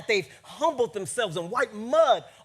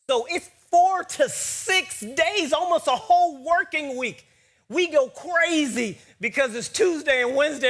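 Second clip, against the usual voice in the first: loud, raised, driving sermon delivery; full-force preaching; 5 semitones higher, 7 dB louder than usual. Acted. Preacher stressing Jesus's delay to the congregation, full-force preaching. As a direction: hammer the long wait with rising intensity.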